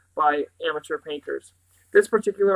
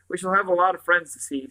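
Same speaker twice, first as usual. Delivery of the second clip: plain reading voice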